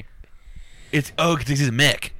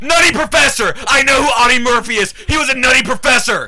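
yelling in outrage; shouting; 12 semitones higher, 11 dB louder than usual. Real, not acted. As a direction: shout it at full volume. Heated shouting argument.

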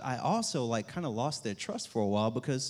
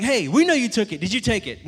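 put-on higher and louder accusing kids; accusing little brothers; 8 semitones higher, 7 dB louder than usual. Brothers confronting older brother, high and accusing. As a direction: confront him like angry kids.

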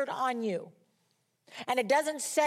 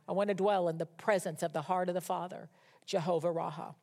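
ordinary talk, nothing marked

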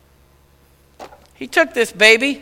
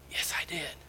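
quiet reply; 8 semitones lower, 20 dB quieter than usual. Retold or imitated. Man on plane quietly answering.

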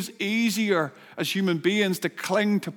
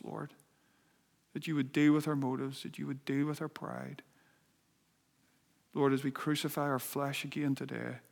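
soft, low prayer voice; hushed earnest prayer; 4 semitones lower, 10 dB quieter than usual. Acted. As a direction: pray quietly, turning inward to God. Leads a prayer.

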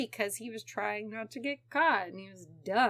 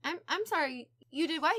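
higher, incredulous double-take; mock disbelief; 6 semitones higher than usual. Investigators hearing a casual confession, mock disbelief. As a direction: play a stunned investigator.

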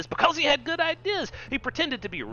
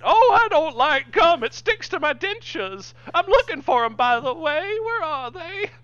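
toothless; 13 semitones higher, 6 dB louder than usual. Acted. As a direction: quaver it out like a toothless old man. Old man bit.

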